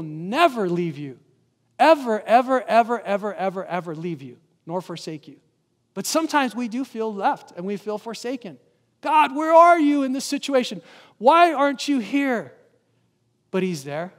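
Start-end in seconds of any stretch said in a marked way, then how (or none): 1.8-3.9 each ever pushed louder then fading; building stress on ever, ever, ever that winds down; repeated emphasis. Real, not acted. Emphatic repetition of promise. 9.0-12.6 higher and louder, a desperate plea; voicing a believer crying to God, a loud pleading call.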